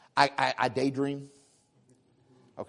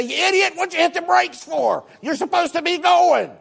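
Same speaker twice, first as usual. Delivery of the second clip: high, louder mock yell at the driver; hammed-up fist-shaking rant; mock road rage; 12 semitones higher, 5 dB louder than usual. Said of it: "you're shaking your fist at him and you say".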